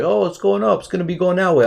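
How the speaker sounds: high mocking voice of clueless traders; sneering at traders who got it wrong, in a high mocking voice; mocking other traders. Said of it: "other people were like".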